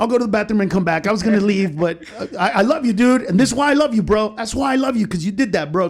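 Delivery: high, effusive acted-out affection; gushing won-over fan. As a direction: imitate a heckler suddenly full of love. Won-over heckler gushing to the comic.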